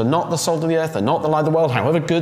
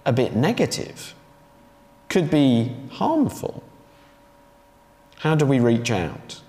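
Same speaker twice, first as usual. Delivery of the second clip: normal talking voice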